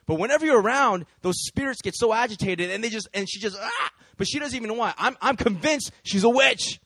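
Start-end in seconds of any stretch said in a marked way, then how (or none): 3.6-3.9 sudden high "ah!"; short high-pitched yelp of agitation; mock outburst. Imitating her agitated by spirits, high mock yelp.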